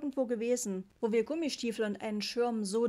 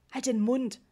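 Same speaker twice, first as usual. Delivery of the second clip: hissed; 3 semitones higher, 7 dB louder than usual. A narrator's reading. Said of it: "fauchte ich".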